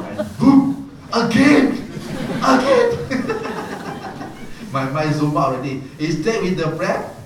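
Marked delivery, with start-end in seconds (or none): 0.0-2.9 laughing, much higher and louder cries of boom; excited outburst. Retold or imitated. Retelling radio blasting during meditation. 2.9-4.7 breathy high laugh at the memory. Real, not acted.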